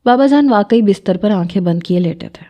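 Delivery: usual speaking voice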